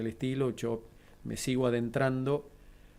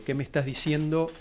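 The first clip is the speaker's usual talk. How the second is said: higher, louder alarmed disbelief, retold; retold shocked question; 5 semitones higher, 5 dB louder than usual. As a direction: re-enact a stunned, urgent demand. Retells his shocked reply to son. (pregunta alarmada e incrédula, revivida)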